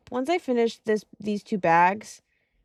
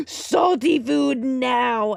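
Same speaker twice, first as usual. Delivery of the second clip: slow, drawn-out craving voice; demanding body voice; 3 semitones higher, 4 dB louder, much slower than usual. Pretend. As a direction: groan it out like a hungry body. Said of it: "my body goes".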